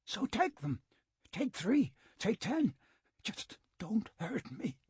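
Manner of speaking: high, frantic pleading; begging. A narrator's reading. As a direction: bargain desperately in a high voice.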